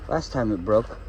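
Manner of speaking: usual speaking voice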